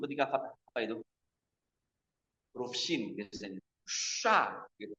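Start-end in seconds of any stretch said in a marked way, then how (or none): 3.9-4.7 Sha with lips pushed out, soft; pouted sha demo. Shown as a demonstration. Teacher showing the wrong pouted sha. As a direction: pout on Sha.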